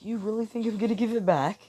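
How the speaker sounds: high-pitched mocking villain voice; acted villain's incredulous question; villainous taunt. Villain Deku taunts in reply.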